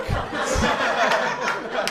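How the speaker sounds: breathy laugh at the memory; amused laugh. Real, not acted. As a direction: laugh it off softly. Laughing at being kicked.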